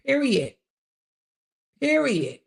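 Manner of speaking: "Period" high, falling sharply at the end; emphatic finality. Real, not acted. Host closing the case on stealing, "Period" high and falling. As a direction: land each "Period" separately, high then falling. Emphatic verdict on quote thieves.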